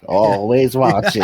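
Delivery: slow, drawn-out creepy slug voice; slow slurpy slug. Pretend. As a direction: drag each word out like a slug. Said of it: "the first thing this dude says".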